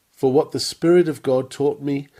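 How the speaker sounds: usual speaking voice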